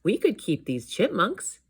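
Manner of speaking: put-on high, eager child's voice; exclaiming nephew. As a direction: exclaim a hopeful idea as a kid. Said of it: "exclaimed Dewey".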